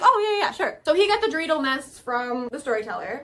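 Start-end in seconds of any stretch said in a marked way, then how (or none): 0.0-0.8 high, offhand yeah-yeah brush-off; breezy fake agreement. Red brushing off the request with a high, breezy yes. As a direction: toss it off like you don't care. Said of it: "She's like".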